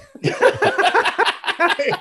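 bursting high laugh; delighted real laugh. Real, not acted. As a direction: laugh out at the joke. Laughs at the joke.

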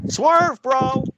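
high, loud wrestling call-out; shouted catchphrase. Retold or imitated. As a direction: yell it like a wrestler.

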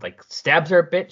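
ordinary delivery

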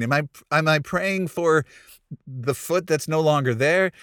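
ordinary talk

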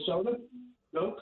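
caller far from the phone; speaking away from the receiver; phone held away. Real, not acted. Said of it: "you gotta talk into the phone".

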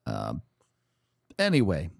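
ordinary talk

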